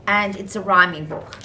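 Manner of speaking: ordinary delivery, nothing marked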